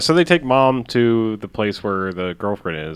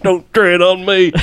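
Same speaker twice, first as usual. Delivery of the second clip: slow, higher, louder slogan; mock patriotic shout; 7 semitones higher, 3 dB louder, slower than usual. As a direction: bellow it like a rally chant.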